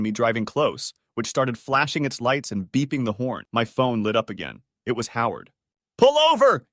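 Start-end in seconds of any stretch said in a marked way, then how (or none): 6.0-6.7 high, loud screamed order; furious scream. A narrator's reading. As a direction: scream it at another driver. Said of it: "he screamed over the car speakers".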